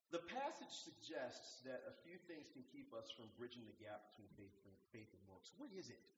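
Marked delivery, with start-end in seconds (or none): none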